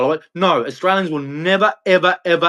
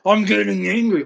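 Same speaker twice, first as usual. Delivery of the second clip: higher, mock-angry taunt; mocking imitation; 4 semitones higher than usual. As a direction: mimic the opponent's anger to ridicule it. Mockingly voicing the angry opponent.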